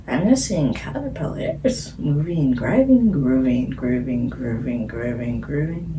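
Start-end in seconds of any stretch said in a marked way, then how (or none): 3.1-5.9 each grooving lower and softer; dreamy fading chant; sinking repeat. Acted. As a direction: let grooving sink down and fade.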